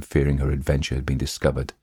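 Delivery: usual speaking voice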